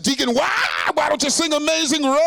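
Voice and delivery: high, loud, wailing call to the deacon; fired-up preacher. Retold or imitated. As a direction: imitate a preacher half-singing at full force. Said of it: "Preacher grabbed the microphone".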